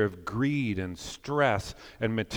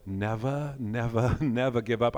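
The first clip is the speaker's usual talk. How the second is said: bad British accent; 2 semitones lower than usual. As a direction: attempt a clumsy British accent, each "never" set apart. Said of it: "I can't do a British accent".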